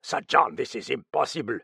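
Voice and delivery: curt Frenchman's snap; snapped. A narrator's reading. Frenchman objecting to Sir John about the girl, snappish. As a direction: cut in with mild exasperation. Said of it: "the Frenchman snapped".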